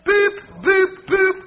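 high mock scanner beeps. Shown as a demonstration. Preacher imitating the self-checkout scanner, playful high beeps. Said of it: "if I wanted to go like this".

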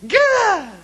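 put-on loud, high baby babble burst; high-pitched happy-baby shout, imitated; baby squeal. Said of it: "have a baby who just wants to talk".

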